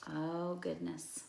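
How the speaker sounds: usual speaking voice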